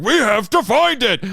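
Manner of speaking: urgent, hammed-up quote; obsessed villains. Imitating the obsessed Tyrannos, mock urgency.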